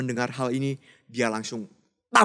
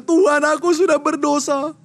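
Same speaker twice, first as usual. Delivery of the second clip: tearful confession; 13 semitones higher, 8 dB louder than usual. Acted. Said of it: "dia menangis".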